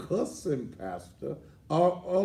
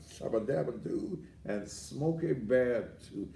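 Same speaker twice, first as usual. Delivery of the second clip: ordinary talk, nothing marked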